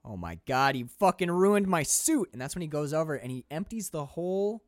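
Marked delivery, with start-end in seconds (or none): 0.0-2.3 loud, high sigh then slow, offended complaint; imitating Gary Oldman's villain. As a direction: play the villain annoyed about his suit. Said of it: "he's like".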